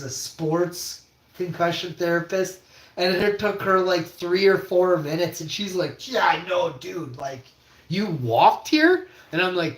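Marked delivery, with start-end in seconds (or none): 6.1-9.1 incredulous. Retold or imitated. Therapist voiced in disbelief.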